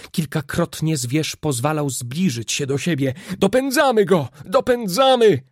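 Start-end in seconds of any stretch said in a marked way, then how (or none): 3.4-4.3 excited call, louder and higher; triumphant hunting shout. A narrator's reading. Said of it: "wołał Kanadyjczyk". 4.4-5.4 repeated call, louder and higher still; rising excited yell; shouting. A narrator's reading. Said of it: "wołał Kanadyjczyk".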